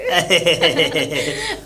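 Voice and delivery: low, deep laugh with rising end; low chuckle. Acted. Bhabhi laughs low at his cheeky talk. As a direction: laugh it off, amused.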